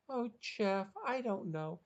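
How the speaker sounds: put-on high-pitched girlish character voice; Sherry. A narrator's reading. Sherry answering Jeff about her pet, high girlish coaxing. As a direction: answer high and coaxing, stretching the last words. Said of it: "Brian could hear Sherry's response".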